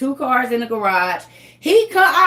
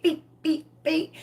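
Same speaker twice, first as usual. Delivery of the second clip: imitated horn beeps; 4 semitones higher, 6 dB quieter than usual.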